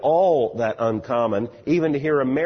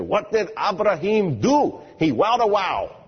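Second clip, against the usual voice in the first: higher, drawn-out mock German accent, V turned to W; very deep German accent; 9 semitones higher, 4 dB louder, slower than usual. German scholar lecturing on Abraham, imitated thick German accent. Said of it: "with this very deep German accent".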